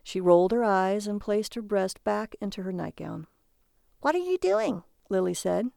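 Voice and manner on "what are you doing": high-pitched annoyed wife; sharply raised pitch, irritated question. A narrator's reading. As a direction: demand it, irked at being ignored. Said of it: "annoyed by the small amount of attention".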